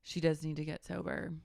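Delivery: normal talking voice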